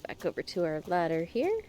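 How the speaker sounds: hoarse, stuffed-up voice; hoarse nasal voice. Real, not acted. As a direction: talk through a hoarse, nasal throat. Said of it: "hoarse, nasally voice".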